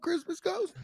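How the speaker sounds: voice for talking to ghosts. Pretend. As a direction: coo at it like a pet. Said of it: "my new ghost, uh, voice that I do for talking to ghosts".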